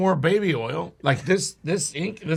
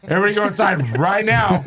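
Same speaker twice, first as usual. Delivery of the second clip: higher, emphatic retold command; urgent order; 4 semitones higher, 4 dB louder than usual. Teller orders everybody inside from the gun, urgent warning. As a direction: raise it into an urgent herding call.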